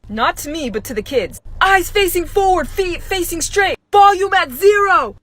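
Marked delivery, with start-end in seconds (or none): 1.6-5.2 slow, loud, high teacher orders; drill-sergeant commands. Retold or imitated. Strict teacher ordering first graders into silence, slow loud commands. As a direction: command the kids like soldiers.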